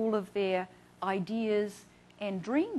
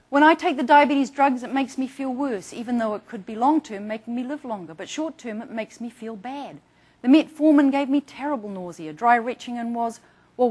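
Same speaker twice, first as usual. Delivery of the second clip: quoting a patient; 3 semitones higher, 11 dB louder than usual. Retold or imitated. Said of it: "some of the comments".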